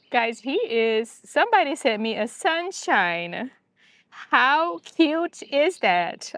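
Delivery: slow, high-pitched gush; sing-song cooing, stretched out; adoring coo. Real, not acted. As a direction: draw it out high, charmed by something cute. Cooing over a sunshine and dog.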